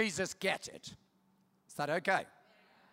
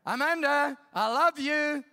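raised, higher call to another room; calling out lovingly; 4 semitones higher, 4 dB louder than usual. Retold or imitated. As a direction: call it through the house so she hears. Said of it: "shout at her".

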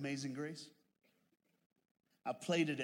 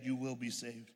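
normal talking voice

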